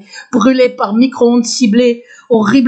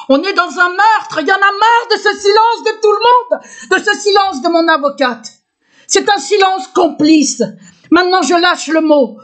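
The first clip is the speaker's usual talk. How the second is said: fed up, pitch raised in protest; higher-pitched exasperated outcry; real indignation; 6 semitones higher than usual. Real, not acted. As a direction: protest the silence, voice climbing high. Fed-up protest at everyone's silence.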